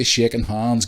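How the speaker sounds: ordinary delivery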